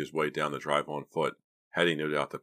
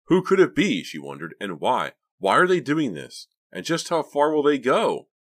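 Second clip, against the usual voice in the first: raised pitch, loud frightened questions; anxious questioning; 10 semitones higher, 8 dB louder than usual. A narrator's reading. Frightened girl wonders who is after her, anxious. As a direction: voice her mounting alarm.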